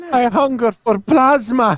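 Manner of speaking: high, slow, whining vampire; whiny vampire voice. Retold or imitated. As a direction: whine it like a cartoon villain. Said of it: "that little whiny voice in my head".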